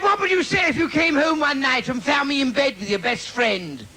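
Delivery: high mock wife. Comic voicing his wife asking a question, high and prim.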